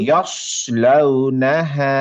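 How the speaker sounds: "ইয়াসলাউনাহা" drawn out slowly, mouth fully open on "ইয়াস"; open yas, slowly. Shown as a demonstration. Teacher reciting the line slowly with an open "ইয়াস". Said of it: "মুখটা পুরো খুলে দিতাম".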